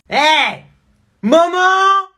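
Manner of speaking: calling mum. Acted. Kid calling mother for help.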